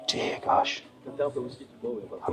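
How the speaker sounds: gasp, then breathe "Dear gosh" in a whisper; a shocked, voiceless gasp at the price. Real, not acted. Shocked at a whisky bottle's price, whispered gasp.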